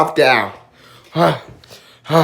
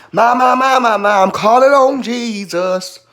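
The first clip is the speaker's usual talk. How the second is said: high gospel-preacher cry; raised, chanting prayer voice; church-style calling out; 5 semitones higher than usual. Pretend. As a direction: call on heaven like a fervent preacher. Minister calling on Jesus over burn.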